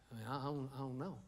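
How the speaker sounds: mock vague mumbled reply; clueless mumble. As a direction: mumble like someone with no reason. Aimless person answering shrug.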